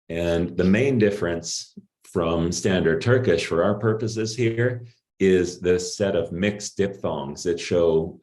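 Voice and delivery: ordinary talk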